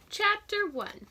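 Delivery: chapter heading. Acted. Teacher announcing the chapter to the class. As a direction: announce the new chapter brightly.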